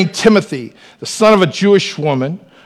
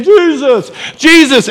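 calling out; 9 semitones higher, 4 dB louder, slower than usual. Retold or imitated. Woman calling out to Jesus.

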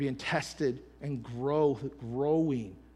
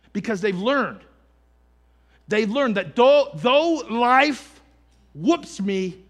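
loud, higher, slow preaching cadence; full-force preaching; 6 semitones higher, 13 dB louder than usual. Acted. Pastor lifting the church toward praise, preaching at full force. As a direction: build it like a sermon climax.

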